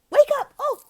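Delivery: loud, very high squeal; startled wake-up call. Shown as a demonstration. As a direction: burst out of the hush, high and sudden. Acted waking of sleeping panda.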